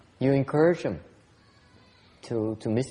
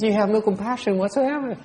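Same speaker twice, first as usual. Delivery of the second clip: mimics his wife's reproach, pitched up; mocking the wife; 7 semitones higher than usual. Teacher quoting his wife's reproach in a mock voice. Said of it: "She says".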